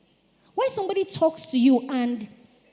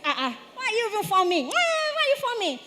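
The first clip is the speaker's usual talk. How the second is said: high, fast, squeaky mock of a rude person; a pitched-up, rushed impression of someone talking back; mocking rude reply; 7 semitones higher, much faster than usual. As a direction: rattle it off high and fast like a cheeky kid. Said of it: "And the person will".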